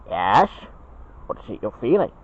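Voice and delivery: usual speaking voice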